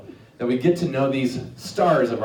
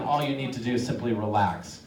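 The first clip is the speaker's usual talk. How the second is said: soft, slow, lower sleep command; hypnotic induction; 7 semitones lower, 8 dB quieter, slower than usual. Acted. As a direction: sink them into trance, softly. Hypnotizing a volunteer.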